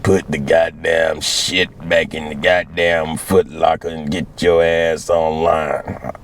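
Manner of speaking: a gruff sergeant laying down the law; slow low drill instructor. Retold or imitated. Drill instructor ordering the recruit back in line, slow and low.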